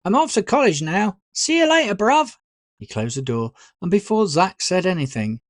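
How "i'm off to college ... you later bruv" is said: high, loud goodbye called through the house; shouting goodbye. A narrator's reading. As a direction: call out as you leave. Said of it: "Dylan shouted".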